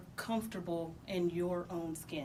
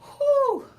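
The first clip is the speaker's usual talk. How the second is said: big loud relieved whew, high and sharp; loud exhale; 14 dB louder than usual. Real, not acted. Telling the interviewer about his dad, a loud high whew. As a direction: blow the air out like it still weighs on you.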